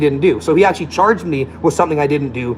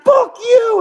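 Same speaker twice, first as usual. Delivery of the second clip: mock yell; 5 dB louder than usual. Man yells after insult.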